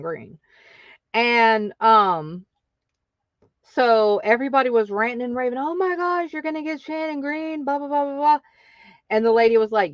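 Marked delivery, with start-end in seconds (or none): 5.6-8.4 high, breathless gushing over a celebrity; gushing group chat voice; mock gushing. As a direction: squeal it like a starstruck fan. Everyone gushing over the guest.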